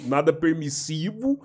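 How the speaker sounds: ordinary talk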